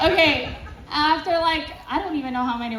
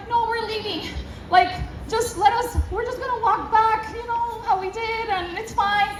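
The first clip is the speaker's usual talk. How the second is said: high, lightly pleading explanation; retold anxious attempt to placate; nervous appeasing; 7 semitones higher than usual. Woman explains to guard they're leaving, nervously placating. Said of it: "I'm scared".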